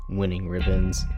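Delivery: ordinary delivery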